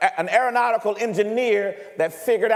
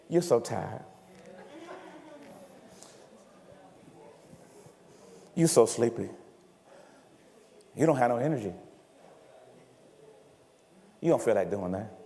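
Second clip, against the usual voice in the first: much lower, softer, drowsy coaxing; lazy inner voice; 8 semitones lower, 8 dB quieter than usual. Retold or imitated. Preacher voicing the mind talking a worker out of working, drowsy. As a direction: lull them toward laziness like a sleepy inner voice.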